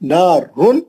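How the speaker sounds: नारुन with a short final n; नारुन rising to a clean, brief n; quick nun. Shown as a demonstration. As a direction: close नारुन promptly on n, no hum. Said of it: "नून को जितना जल्दी पढ़े".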